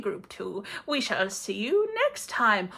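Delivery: ordinary talk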